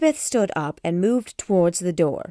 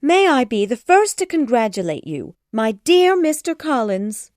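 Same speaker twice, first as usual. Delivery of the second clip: high, loud gushing; excited mother congratulating suitor; eager congratulation; 6 semitones higher, 6 dB louder than usual. A narrator's reading. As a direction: fuss over him delightedly. Mrs Bennet congratulating eagerly.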